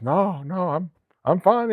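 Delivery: high-pitched imitation of brushing off worry; insistent reassurance. Crash survivor to disbelieving officer, insistent reassurance. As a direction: pitch it up, stretching the end as if all is well.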